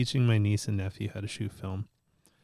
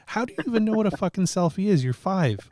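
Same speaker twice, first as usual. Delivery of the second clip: fast, higher, louder incredulous rant; exasperated disbelief; 10 semitones higher, 5 dB louder than usual. Retold or imitated. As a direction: uncle baffled the kid knows selfies. Uncle exasperated at nephew.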